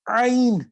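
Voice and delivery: عین pushed back and squeezed in the throat; Arabic ain demo; pressed ain. Shown as a demonstration. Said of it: "اس کو آپ نے پیچھے کی طرف".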